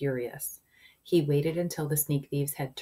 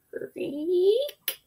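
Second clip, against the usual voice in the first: high drawn-out creak; squeaky trapdoor imitation; creaking noise; 5 dB louder than usual. A narrator's reading. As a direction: stretch the word into a high creak. Sound effect of creaking door.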